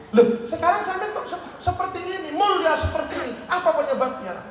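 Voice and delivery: high-pitched astonished questioning; amazed questioning. Retold or imitated. Hasan asks the woman in his dream, high amazed voice. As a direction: ask in acted amazement.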